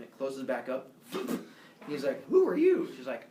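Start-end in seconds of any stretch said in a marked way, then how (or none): 2.3-2.9 high, loud acted bewilderment; startled question. Zeus facing the newly grown stranger, high startled question. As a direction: demand it in comic shock.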